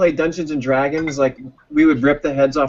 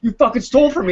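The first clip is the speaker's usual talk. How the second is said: higher, half-mock angry complaint; a hint of a robbed friend griping; mock pissed friend; 8 semitones higher, 3 dB louder than usual. Robbed player griping at the halfling thief, mock pissed. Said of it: "Josh would get pissed".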